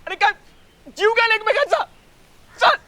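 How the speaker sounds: high-pitched, urgent shouting; shouting to intervene. Acted. Friend breaks up a fight between two others, urgent shouting. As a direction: yell to pull the fighters apart.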